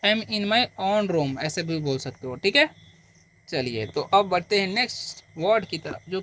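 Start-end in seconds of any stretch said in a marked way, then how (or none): 0.1-1.4 धीमा स्पष्ट उच्चारण. Shown as a demonstration. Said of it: "मैं अपने कमरा में हूँ".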